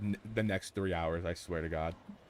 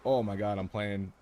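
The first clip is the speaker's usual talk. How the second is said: usual speaking voice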